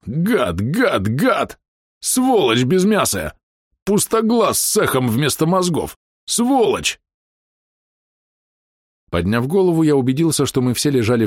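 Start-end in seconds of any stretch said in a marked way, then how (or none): 0.0-7.0 high, slow bellowed insults; furious roar. A narrator's reading. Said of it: "ревел Бом".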